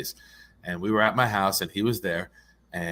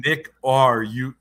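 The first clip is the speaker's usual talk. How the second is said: "Nick, are you" slow and louder, word by word; slow demonstration; 3 semitones higher, 6 dB louder, slower than usual. Shown as a demonstration. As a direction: spell out the sound of "Nick, are you" slowly. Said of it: "And when you say".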